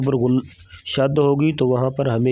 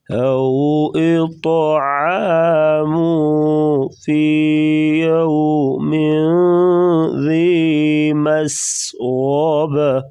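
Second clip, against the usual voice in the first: slow, melodic tajweed chant; Quran recitation; 2 semitones higher, much slower than usual. Shown as a demonstration. Teacher reciting for students, slow melodic tajweed. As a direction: draw each word out slowly in recitation. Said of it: "یہاں پر قلقلہ کریں گے".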